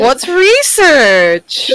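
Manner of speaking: slow, drawn-out clueless question; mock clueless. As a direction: play dumb, stretch research out slowly. Mock ignorant question about research.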